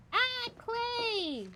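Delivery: very high, long holler falling at the end; high-pitched stretched call; high drawn-out cry. Acted. Calling out to a companion.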